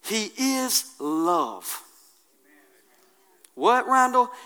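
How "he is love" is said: very slow, soft and low; hushed reverent statement; tender declaration. Acted. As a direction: let each word land gently.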